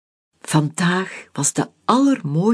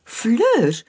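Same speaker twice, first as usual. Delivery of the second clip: calling out; 8 semitones higher than usual. Acted. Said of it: "riep ik".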